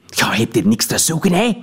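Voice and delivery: a hint of a hateful hiss; venomous sneer. Woman tells the narrator he has no business here, sneering. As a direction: spit it out with poison. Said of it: "sneerde ze giftig".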